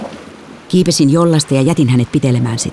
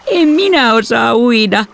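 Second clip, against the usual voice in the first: slow, high-pitched whine; drawn-out, miserable kid's whinge; pitiful whining; 9 semitones higher, slower than usual. A narrator's reading. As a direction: whimper it like a helpless child. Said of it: "otti surkean ilmeensä ja vinkui".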